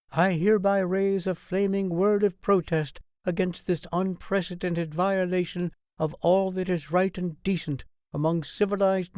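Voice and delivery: a queen rousing her nation in protest; outraged queen's address. A narrator's reading. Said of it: "clear female tones filled with outrage".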